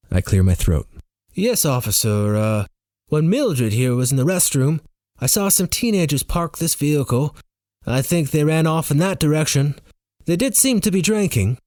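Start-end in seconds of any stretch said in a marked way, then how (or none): 1.3-11.6 put-on higher-pitched muddle of English, Jamaican and Norwegian accents; lilting, climbing, confused put-on accent; mismatched fake accent. A narrator's reading. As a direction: fake a foreign accent and miss. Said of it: "I try to match her accent".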